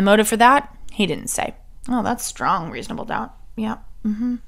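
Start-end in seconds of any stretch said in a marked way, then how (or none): 1.9-4.4 sarcastic. Shown as a demonstration. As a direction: feign agreement softly, dripping with doubt. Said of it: "that's my sarcastic voice".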